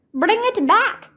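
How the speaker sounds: lifted call across the yard. A narrator's reading. Annie calling her puppy back, lifted call. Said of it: "Annie called".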